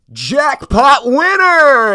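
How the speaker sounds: loud, high, drawn-out machine announcement; imitated jackpot fanfare voice; slot machine jackpot sound. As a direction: blare it like a winning slot machine. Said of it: "the fucking sound comes on".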